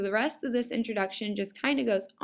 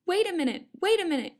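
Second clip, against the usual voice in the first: high-pitched mock alarm; urgent cry; 7 semitones higher, faster than usual. A narrator's reading. Jack the narrator interrupts the story, crying out. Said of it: "cried Jack the narrator".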